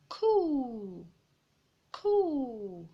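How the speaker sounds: high, loud birdlike coo; imitating a cooing bird. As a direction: coo like a dove. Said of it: "sing the birds".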